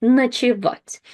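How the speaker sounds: Ночевать with the first O said as a, stress on -вать. Shown as a demonstration. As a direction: say Ночевать as nachevat. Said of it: "unstressed O is pronounced as а".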